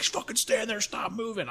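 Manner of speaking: high-pitched imitated drill order; training instructor. Instructor to a sick recruit, high-pitched scolding order. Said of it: "He's like".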